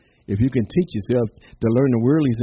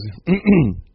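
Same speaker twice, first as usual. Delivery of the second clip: pointed throat-clear before a speech; theatrical throat clearing; 5 semitones higher, 4 dB louder than usual. Acted. Pig about to address the chicken, mock throat clearing. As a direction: clear your throat importantly, in play.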